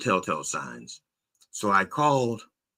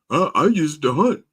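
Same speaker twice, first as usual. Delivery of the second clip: high, loud mocking voice; mocked hunter; 8 semitones higher, 6 dB louder than usual. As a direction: mimic a lame excuse, pitched up. Mimics gun owners' excuse.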